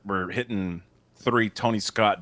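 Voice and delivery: normal talking voice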